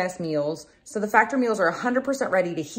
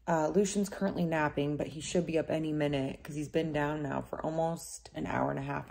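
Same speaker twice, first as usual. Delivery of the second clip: ordinary delivery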